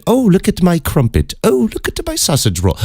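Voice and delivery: high, prissy mock-English voice; mock British pedestrian. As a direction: parody a chirpy Brit strolling along. Mocking oblivious British pedestrian.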